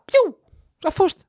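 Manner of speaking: a high loud "piu" like a toy gun; cartoon pew sound; high gunshot noise. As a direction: shoot them with your mouth. Imitated gunshot.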